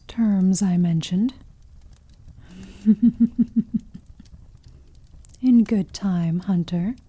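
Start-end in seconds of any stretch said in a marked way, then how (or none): 2.6-4.0 amused predatory chuckle; knowing chuckle. Acted. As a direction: laugh as if the secret is yours. Vampire laughs at hunter's terms.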